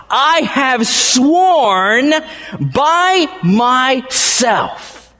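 very slow, high proclamation; solemn slow divine oath; drawn-out oath. Acted. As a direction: stretch every word like a vow. Preacher voicing God's oath.

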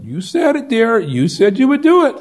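high, sing-song told-you-so; chiding wife voice; wife's reply. Retold or imitated. Wife reminding her husband of his promise, high and chiding.

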